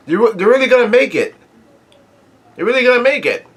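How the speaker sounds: high-pitched delighted disbelief; real excitement. Real, not acted. Reader reacting to a heartwarming chapter's end, real excitement. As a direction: exclaim it high, thrilled for them.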